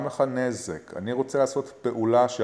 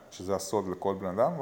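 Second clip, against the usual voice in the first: usual speaking voice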